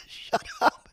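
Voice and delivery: very high breathless squeaked shut up; incredulous shut up. Retold or imitated. Voicing the skier's stunned look at a bragging host, high squeal. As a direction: squeal it in mock disbelief. Jeff's look, voiced again.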